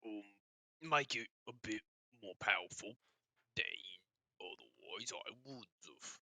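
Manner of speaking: slow, halting orc smith; put-on orc voice that strains the throat; softly spoken orc, pausing often; strained orc voice. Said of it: "I am having to stop that voice 'cause it's hurting".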